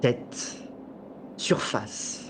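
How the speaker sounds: calm, measured storytelling voice; game master narration. A narrator's reading. Game master sets the scene.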